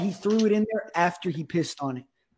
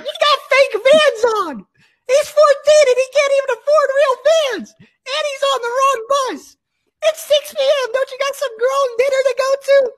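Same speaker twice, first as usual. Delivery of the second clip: put-on high, shrieking kid voices roasting, laughing; cackling grade-schoolers jeering at the big kid; mocking fifth graders; 5 dB louder than usual. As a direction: squeal insults like bratty little kids.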